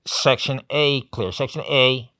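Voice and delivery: slow, forceful mock radio report; walkie-talkie voice. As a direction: bark it like security over a walkie-talkie. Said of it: "another walkie-talkie going".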